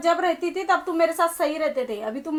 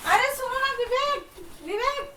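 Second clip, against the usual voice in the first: higher, softer, slow plea after him; pleading call; 5 semitones higher, 5 dB quieter, slower than usual. Acted. Wife calling after Vivek as he leaves, soft higher plea. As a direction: call his name as he walks away.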